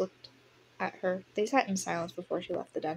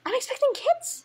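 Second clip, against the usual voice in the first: very high, louder acted announcement; squealed, thrilled confiding; excited; 5 dB louder than usual. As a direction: burst out with happy news. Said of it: "she told her excitedly".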